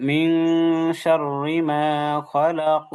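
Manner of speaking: খালাক ending on a bouncing qaf; measured model of খালাক with qalqalah; qalqalah demonstration. Shown as a demonstration. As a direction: recite মিন শাররি মা খালাক, echoing the final qaf. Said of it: "কলকলাটা ঠিকঠাক আদায় করা দরকার".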